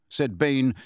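usual speaking voice

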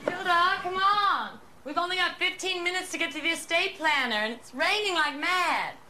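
exasperated summons; impatient calling out. Acted. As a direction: call your sister from afar, high and urgent. Calling impatiently for her sister.

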